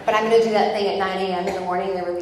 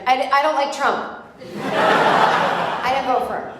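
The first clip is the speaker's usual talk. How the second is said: flustered protest; 5 semitones higher, 4 dB louder, slower than usual. Retold or imitated. Her flustered reply to the guide.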